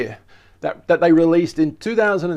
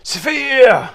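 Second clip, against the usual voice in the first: high mock German outburst; hammed-up angry German accent, pitched well up; 8 semitones higher, 4 dB louder than usual. Presenter repeating the word for viewers, angry German. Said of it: "Sound like an angry German".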